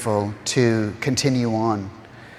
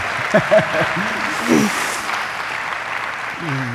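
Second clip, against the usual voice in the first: tearful laugh; 6 semitones higher than usual. Real, not acted. Laughs through tears.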